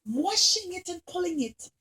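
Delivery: plain reading voice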